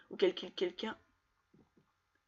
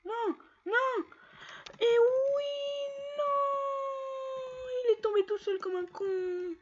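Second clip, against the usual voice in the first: frustrated yelling; much slower than usual. Real, not acted. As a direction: howl at the screen in disbelief. Character dies in game. (cris aigus d'exaspération)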